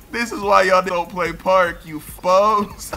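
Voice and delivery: gloating taunt. Real, not acted. Taunting opponents.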